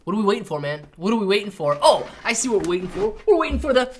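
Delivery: ordinary delivery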